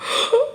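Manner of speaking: high, quiet intake of breath; shocked gasp. Real, not acted. Host reacting to "I need a breather", shocked gasp. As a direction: gasp in disbelief.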